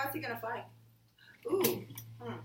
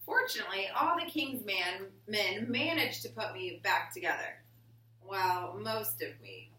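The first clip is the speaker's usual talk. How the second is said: put-on reading to children. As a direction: read it to the class like a picture book. Reading picture book aloud.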